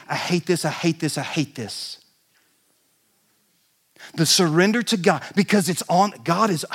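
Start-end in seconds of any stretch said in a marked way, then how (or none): 0.1-2.0 grudging complaint. Retold or imitated. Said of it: "it should not be".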